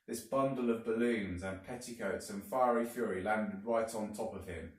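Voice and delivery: plain reading voice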